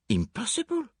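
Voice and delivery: high, incredulous Poirot; startled disbelief. Acted. Poirot finds the case unlocked, high disbelief.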